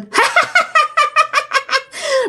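high eerie ghost cackle; ghostly laugh. Retold or imitated. Ghost on the goalpost laughing at the couple. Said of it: "Bắt đầu cười lên".

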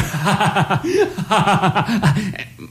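a burst of high laughter; real laughter. Real, not acted. Laughs at grammar joke.

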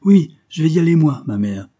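loud, eager outburst; exalted. A narrator's reading. Geneviève volunteers to guide the soldiers, exalted. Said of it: "dit Geneviève avec exaltation".

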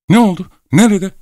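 very high, agitated questions; breathless urgency. A narrator's reading. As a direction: fire off anxious questions after running up. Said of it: "Soluk soluğa yüksek sesle".

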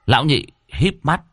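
ordinary delivery, nothing marked

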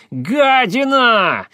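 shrieking high, drawn out; hysterical scream. Acted. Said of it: "истерично кричала она". (истеричный крик)

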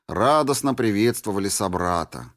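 ordinary delivery

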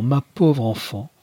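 drawn-out pitying cry; slow, rising lament. A narrator's reading. Mother embraces her daughter, slow rising lament. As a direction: lift the repeat into a slow wail.

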